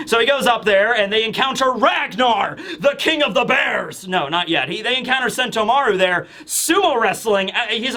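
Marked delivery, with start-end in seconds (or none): 1.7-4.1 higher, drawn-out mock shout; slow, hammed-up epic reveal; mock-epic announcement. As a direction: announce a monster king with hammed-up grandeur. Mock-epic introduction of a bear king.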